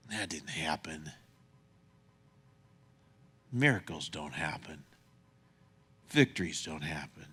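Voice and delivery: soft, breathy hush of disbelief; whisper. Acted. Preacher voicing doubt to the congregation, whispered.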